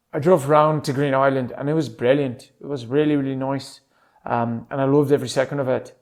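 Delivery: relaxed Irish lilt; Irish accent. Shown as a demonstration. Demonstrating Irish expressions from his trip, Irish accent. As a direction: tell it like an Irishman. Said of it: "that's my Irish accent".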